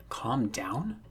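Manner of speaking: a voiceless whisper, questioning upward; incredulous whisper. Acted. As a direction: breathe it out in disbelief. Voiceless, furious, hurt boy.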